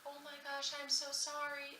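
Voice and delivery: high-pitched, mortified apology; embarrassed apology. Retold or imitated. Child apologizing after jumping on a stranger, high and embarrassed. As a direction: say sorry in a small, high voice. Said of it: "it was so embarrassing".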